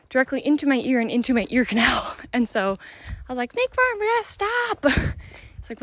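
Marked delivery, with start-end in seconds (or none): 3.5-4.8 panicked squeak, way up high; high-pitched alarm at friend; urgent high voice. Retold or imitated. Retold panic, bug in ear.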